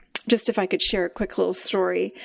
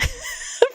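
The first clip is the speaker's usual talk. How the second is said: high laugh. Real, not acted. Laughing at her own basil story, high and bright.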